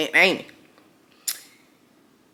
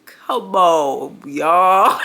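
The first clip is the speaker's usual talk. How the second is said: drawn-out plea through held-back laughter; stretched-out, half-cracking protest; trying not to laugh; much slower than usual. Retold or imitated. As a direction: beg them to stop while fighting a laugh. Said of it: "I'm trying not to laugh".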